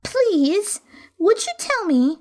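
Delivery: put-on high, hesitant little girl voice; timid young girl. A narrator's reading. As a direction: ask shyly, like a small girl. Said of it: "said Alice a little timidly".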